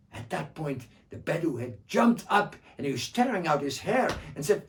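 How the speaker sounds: usual speaking voice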